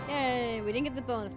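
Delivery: ordinary talk, nothing marked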